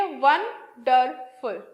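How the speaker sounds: वंडरफुल said very slowly, वं-डर-फुल in three parts; syllables drawn out. Shown as a demonstration. Teacher showing students the three syllables of वंडरफुल, slowly split. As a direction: stretch वंडरफुल into three slow beats. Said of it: "ऐसे सुनाई आया".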